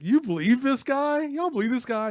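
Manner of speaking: high, loud, incredulous taunt; mock laughing jeer. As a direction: play the thug scoffing to his gang. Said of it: "the guy starts laughing".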